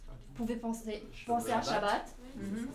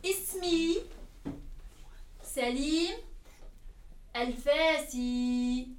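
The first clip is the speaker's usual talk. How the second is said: slow teacher modelling; 4 semitones higher, slower than usual. Shown as a demonstration. Teacher models an Arabic introduction for learners, slow and drawn out. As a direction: stretch the last syllables, word by word.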